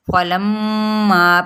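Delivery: فلما very slow with ghunna held on the meem; Quranic recitation. Shown as a demonstration. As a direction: draw out فلما with a nasal meem. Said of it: "پہلے غنہ میم پہ". (میم پر غنہ کے ساتھ کھینچ کر)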